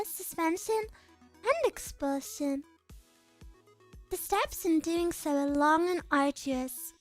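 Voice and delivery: put-on composed noble girl. Reads a game character's lines.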